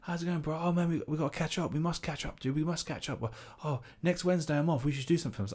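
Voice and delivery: high, light, gushing voice; soft high-pitched mock enthusiasm; fake-keen reunion. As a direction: act out an overeager long-lost mate. Overeager reunion with acquaintance acted.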